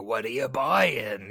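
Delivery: slow, lightly voiced shady merchant; a hint of the creepy shopkeeper; secretive raspy sales murmur. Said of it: "the guy from Resident Evil four who's like".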